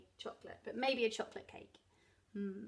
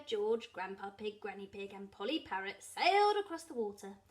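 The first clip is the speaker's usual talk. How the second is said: usual speaking voice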